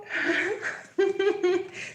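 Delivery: high, breathless fit of giggles; real giggling laugh. Real, not acted. Reaction to next card.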